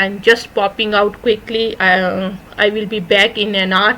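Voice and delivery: very slow, careful delivery; slow model sentence. Shown as a demonstration. Teacher reading a daily phrase very slowly. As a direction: stretch each word for the class. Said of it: "بس میں ایک گھنٹے میں آیا".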